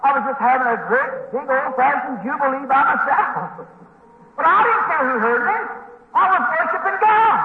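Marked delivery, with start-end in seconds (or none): 4.4-7.4 high, unashamed declaration; fervent preaching. Real, not acted. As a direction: lift the pitch high and proclaim it. Reliving his shouted worship.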